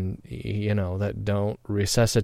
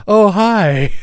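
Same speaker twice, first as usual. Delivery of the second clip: much higher, louder, light mock greeting of a just-woken mom; mom waking up; 11 semitones higher, 9 dB louder than usual. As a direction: play his mother surprised to see a friend. Mom waking, voiced in story.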